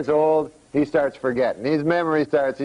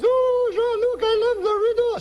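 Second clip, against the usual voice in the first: squeaky-high falsetto wife; high woman's voice. Acted. Wife delighted by uncle's riddle, high falsetto woman's voice. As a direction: go way up into comic falsetto. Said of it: "She's a woman, high voice".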